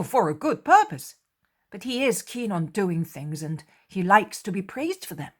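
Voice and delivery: judging Judas aloud; opinionated apostle. Acted.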